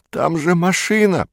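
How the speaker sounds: high-pitched plaintive groan; moaning. A narrator's reading. Perets is held back from the car, moaning. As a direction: moan it high, in despair. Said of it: "простонал он".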